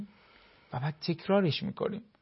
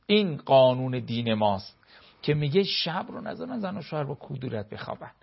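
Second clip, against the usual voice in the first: ordinary talk, nothing marked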